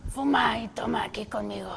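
put-on raspy whispered drunk voice; raspy drunk aunt. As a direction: rasp it out like a tipsy aunt. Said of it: "empezaba a hablar rasposo".

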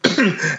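rough throat clear. Real, not acted. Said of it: "as I choke to death".

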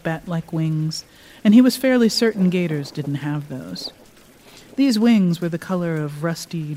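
calm, measured reading aloud; story narration. A narrator's reading. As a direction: read it like a storyteller. Author reads her story aloud.

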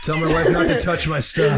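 laughing through fake dying words; giggling, hammed-up deathbed wish; laughing mock last words.

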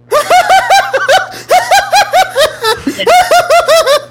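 huge high-pitched cackling laugh; maniacal laugh. Shown as a demonstration. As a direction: shriek with wild villain laughter. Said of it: "you like that one better?".

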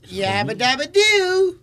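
Fred Flintstone catchphrase. Retold or imitated. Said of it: "él lo decía más hombrecito".

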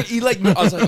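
ordinary talk

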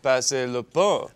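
slow, showy schoolboy French; hammy French accent. Pretend. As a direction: show off your French, hamming it up. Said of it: "show us all your French".

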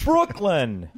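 New York accent. Shown as a demonstration. Proving his accent to the hosts, New York accent. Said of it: "that was close".

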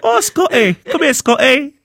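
high, loud call to a pet dog. Pretend. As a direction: coax the dog over like a pet owner. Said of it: "he's now turned into a dog".

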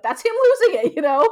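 high, loud and fast, breaking into a laugh. Real, not acted. Wife amused at how her husband loses it, laughing. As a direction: burst out laughing through the words.

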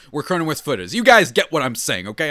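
slightly slurred speech; slurred words. Real, not acted. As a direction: let the words slur together a bit. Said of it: "I'm slurring my words a little bit".

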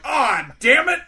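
high, loud hammed-up curse; mock frustrated cop. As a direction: play the cop fuming he was fooled. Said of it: "he's like".

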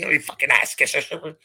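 sneering mock voice of a troll; mocking Chase. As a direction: ham up the petty online troll. Chase's DMs to his followers.

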